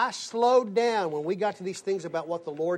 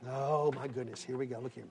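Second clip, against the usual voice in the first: muttered aside; 7 semitones lower, 9 dB quieter than usual. Real, not acted. Mutters to himself.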